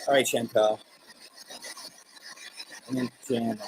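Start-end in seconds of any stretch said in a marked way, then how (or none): 2.9-3.6 radio tuning in. Real, not acted. Spirit box voice answering.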